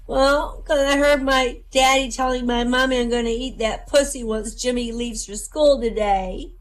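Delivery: high-pitched, hammed-up child's whine; mock crying. Schoolboy answering his teacher, mock crying. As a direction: play a weepy little boy, dragging the last words. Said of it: "And Jimmy was crying".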